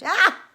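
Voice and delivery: loud high yelp of pain; pained yell. Acted. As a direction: cry out in sudden pain. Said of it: "he yelled".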